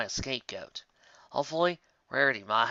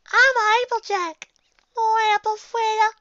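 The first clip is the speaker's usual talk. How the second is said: much higher and louder, slow silly cartoon voice; mock Applejack; 8 dB louder, slower than usual. Narrator mocking Applejack's apple obsession, high silly voice. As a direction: ham up a goofy apple mascot.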